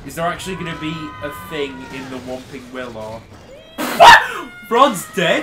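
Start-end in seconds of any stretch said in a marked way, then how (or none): 3.3-4.6 loud, high shriek of laughter; sudden high cackle; burst of laughter. Real, not acted. Startled laugh at the game. 4.7-5.4 loud, high incredulous question; startled, raised-voice disbelief; shocked question. Real, not acted. Shocked reaction to game.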